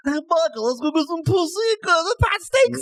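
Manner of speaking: high, hammed-up Italian Guido car voice; a mock Guido from Philly tossing off street talk; Italian Guido accent. Car in Knight Rider speaking to Michael in a hammed-up Guido accent. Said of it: "like a Guido accent".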